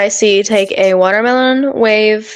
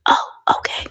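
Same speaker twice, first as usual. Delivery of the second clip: whispering; 7 dB quieter than usual. Real, not acted. Whispered chat continues.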